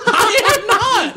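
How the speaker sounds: high, drawn-out protest through laughter; mock indignant. Real, not acted. Host denies switching sides in the argument, mock indignant. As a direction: squeal the denial in mock outrage.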